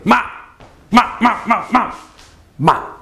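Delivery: "ma" yelled out short, high and falling; quick falling bursts of "ma"; sharp fourth tone. Shown as a demonstration. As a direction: throw out each "ma" as a sharp high drop. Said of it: "just yell out a word sharply".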